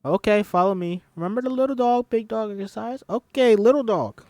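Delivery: high loud excited teacher. Acted. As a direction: lead the class, thrilled. Excited teacher leads exercise.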